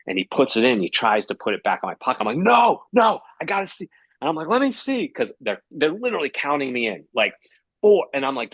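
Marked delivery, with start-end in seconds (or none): 2.4-3.9 raised, urgent mock refusal; panicked protest. Acting out his frantic refusal to run out. 4.5-5.1 high-pitched, urgent mock plea; urgent demand. Acting out his demand to see cards.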